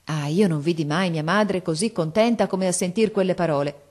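ordinary delivery, nothing marked

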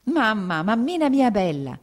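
put-on a little boy throwing himself at his mother; child's glad cry. A narrator's reading. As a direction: son cries out hugging mother. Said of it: "egli gridò".

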